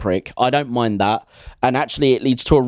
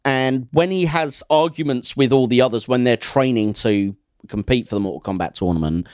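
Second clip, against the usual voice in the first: ordinary talk, nothing marked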